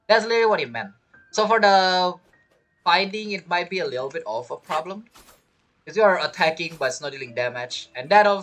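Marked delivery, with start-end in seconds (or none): none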